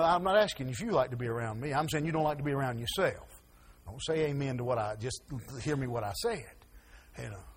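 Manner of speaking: normal talking voice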